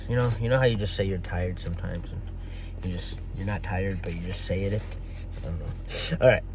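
normal talking voice

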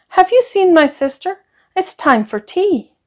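put-on high boyish voice asking; little boy asking. A narrator's reading. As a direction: sing it up like an eager small boy. Ben asking Plain Jane.

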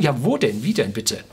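usual speaking voice

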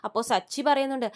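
usual speaking voice

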